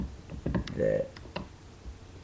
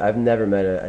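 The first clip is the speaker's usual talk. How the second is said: ordinary talk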